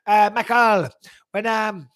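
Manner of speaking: put-on French Canadian accent; George Saint Pierre. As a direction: imitate a French Canadian coach, halting and stumbling. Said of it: "I'll stop the accent".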